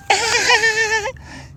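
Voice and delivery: very high squealing goat calls. Pretend. Owner copying Lulu's squeal for viewers. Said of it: "So high pitched".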